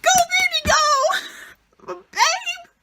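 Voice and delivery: high, excited cheer; root for her like a fan. Real, not acted. Reviewer cheering Cassandra on, high and thrilled.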